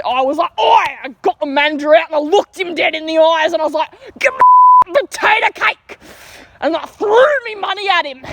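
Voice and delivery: mock him bragging to his gang friends; mocking tough guy boast. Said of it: "totally real gang friends and he's like".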